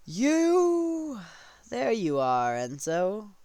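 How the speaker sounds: high, loud, long-held cry; accusing cry. Retold or imitated. King spots Enzo thrown outside, long loud cry. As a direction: stretch it out as you spot your target.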